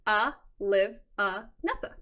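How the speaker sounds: "Uh, Liv, uh, Nessa" broken into separate parts; syllable-by-syllable demo. Shown as a demonstration. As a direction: sound out "Uh, Liv, uh, Nessa" with gaps between parts. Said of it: "That's how it's pronounced".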